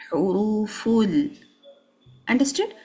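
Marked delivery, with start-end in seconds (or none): 0.1-1.4 "huruful" slow, with long held u vowels; full timing. Shown as a demonstration. Teacher modelling correct joining for students, fully timed. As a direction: stretch both u sounds in "huruful". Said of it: "do not reduce the timing".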